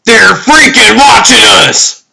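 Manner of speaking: high, full-volume alarmed shout; panicked yell. Acted. Someone realizing they are being watched, panicked yell.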